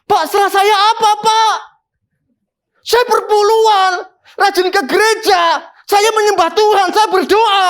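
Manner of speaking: high pleading voice of a weeping woman, half-acted; imitating a crying plea. Sick woman phoning her pastor, mock tearful high plea. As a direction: pitch it up into a tearful, desperate plea. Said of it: "telepon saya nangis".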